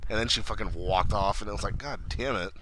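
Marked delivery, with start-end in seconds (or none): 1.8-2.5 muttered curse. Retold or imitated. Her muttered curse retold.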